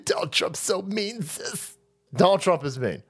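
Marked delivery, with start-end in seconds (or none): 0.0-1.8 fast, softer mock whine of a tearful fan; a hammed-up weepy girl whining; mock crying fan. Mocking a [name] fan about to cry, fast and soft. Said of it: "She's gonna cry".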